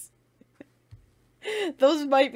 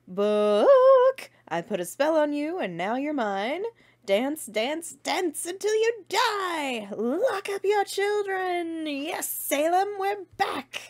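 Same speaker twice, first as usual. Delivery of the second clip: Winifred quotes; 9 semitones higher, 4 dB louder, slower than usual. Retold or imitated. Reciting favorite Winifred quotes.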